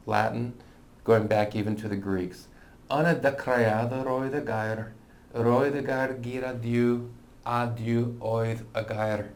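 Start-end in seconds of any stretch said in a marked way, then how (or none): 2.9-9.3 verse read aloud in Welsh pronunciation. Shown as a demonstration. Teacher reads John one one in Welsh, Welsh pronunciation. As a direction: read it carefully and slowly as Welsh.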